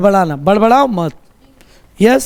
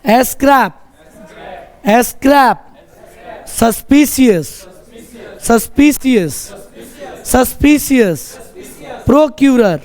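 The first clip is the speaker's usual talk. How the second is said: raised-pitch classroom drill, word by word; choral repetition drill; 4 semitones higher than usual. Shown as a demonstration. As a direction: lead the class in repeating each word. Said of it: "सब कोई बोलेगा".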